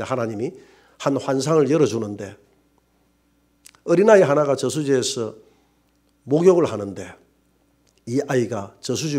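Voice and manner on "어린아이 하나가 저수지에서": normal talking voice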